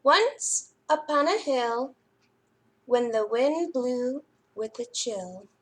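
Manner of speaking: lively, expressive storytime read-aloud; reading to children. A narrator's reading. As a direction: read it like a librarian to little kids. Reads picture book to children.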